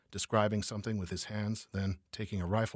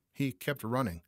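usual speaking voice

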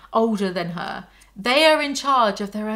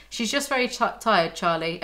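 normal talking voice

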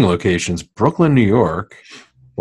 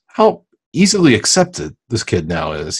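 usual speaking voice

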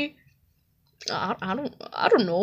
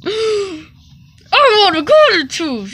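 excited gasp; 6 semitones higher, much slower than usual. Acted. Thrilled at golden tooth.

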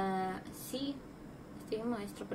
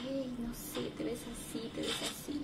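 hushed, breathy imitation of a creep; quietly mock a catcaller, last words whispered; hint of an unwelcome admirer, much softer; creepy compliment; 11 dB quieter than usual. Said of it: "cuando alguien me dice".

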